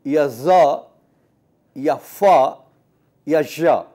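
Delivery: زا، فا، ژا said crisply and loudly, each sound held clear; sound drills. Shown as a demonstration. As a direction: drill زا، فا، ژا one by one with a clean consonant. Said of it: "پانچ چھ آوازیں یہ ایسی آوازیں ہیں". (آوازوں کی مشق)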